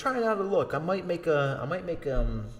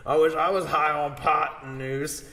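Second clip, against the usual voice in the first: mock classroom blurt, louder and higher; louder to the class; 4 semitones higher, 5 dB louder than usual. Key repeats the joke loudly to the class, louder. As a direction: announce it to the whole room. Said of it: "said it louder in class".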